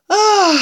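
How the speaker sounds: high, loud "ah"; breathless gasp. A narrator's reading. Cub with throat burning from cider, high gasp. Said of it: "le souffle coupé".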